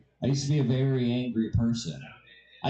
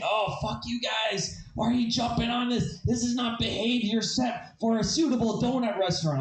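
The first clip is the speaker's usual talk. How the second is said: raised pitch, indignant scolding; cursing Cuban stranger; 7 semitones higher than usual. Retold or imitated. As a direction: scold the kids in a higher, put-out voice. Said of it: "cursing my sister out".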